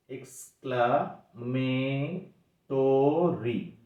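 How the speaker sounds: এক্সক্লামেটরি split slowly into separate syllables. Shown as a demonstration. Teacher to class, slowly breaking এক্সক্লামেটরি into syllables. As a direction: break এক্সক্লামেটরি apart, one syllable at a time. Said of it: "এই যে ভেঙে ভেঙে করছে".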